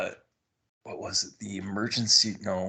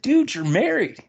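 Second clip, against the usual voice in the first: high, loud mock reproach; incredulous reminder; 14 semitones higher, 5 dB louder than usual. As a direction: re-enact calling out a friend, voice shooting up. Retold scolding of married friend.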